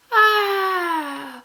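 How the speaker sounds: long high-pitched mock scream; hammed-up drawn-out yell; acted high, loud "aah". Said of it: "And so Harry's like".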